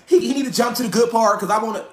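a hint of an impatient commenter demanding the good part; mock impatient viewer. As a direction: streamer mocking an impatient viewer, whiny. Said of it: "Don't try to get on here and".

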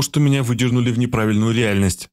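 ordinary talk

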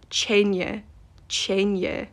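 Chenya said slowly, chen-ya, and repeated; correct Gaelic. Shown as a demonstration. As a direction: say Chenya clearly and slowly twice. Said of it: "when she calls Mira's dragon Tyne".

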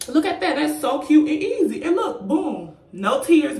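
high, loud, with bubbling laughter; delighted hype. Real, not acted. Vlogger showing viewers a cute, easy lash, delighted. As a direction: show it off with excited punch.

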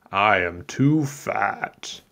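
very slow, lightly voiced baker; fat baker. A narrator's reading. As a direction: stretch out the baker's excuse. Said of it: "The baker said".

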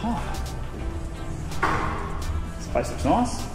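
usual speaking voice

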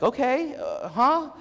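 high, puzzled and hesitant; lost, not getting it; confused okay. Retold or imitated. Ethan to the therapist, puzzled and high.

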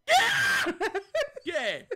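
grida acute tra le risate; spaventato che ride. Real, not acted. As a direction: urla chi è ridendo di paura. Mob attacks, shouting laughing. (attaccato da un mostro, urla ridendo)

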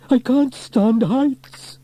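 high, loud, drawn-out wail; panicked wail. A narrator's reading. Trevor hanging in the belfry, wailing in panic. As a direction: wail it in terror of heights. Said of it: "Trevor cried".

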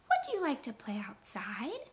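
whispered, rising at the end; whispered question. Shown as a demonstration. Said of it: "ask it with a question".

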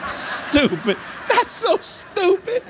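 laughing mock scorn. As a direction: ham up the self-mockery, stretching it out. Mocking his own petty jealousy.

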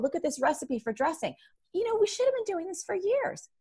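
high, excited mock gushing; gushing excitedly. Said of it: "I come home one day and I'm like".

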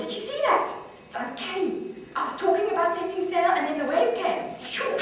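high-pitched astonishment; amazed. Acted. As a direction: marvel at an uncanny coincidence. Amazed at the wave's timing.

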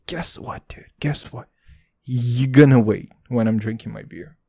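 ordinary delivery

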